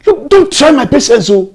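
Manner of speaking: high, loud angry threat; furious warning. Acted. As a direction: warn at the end of your patience, shouting. Father warns defiant daughter.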